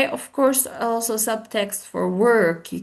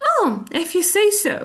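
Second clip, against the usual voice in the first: higher, quick and agreeable; pleased acceptance; 7 semitones higher, much faster than usual. Shown as a demonstration. Teacher shows the good meaning, higher and pleased. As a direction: take it as a good thing, pitched up and brisk. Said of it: "It also can mean actually a good thing".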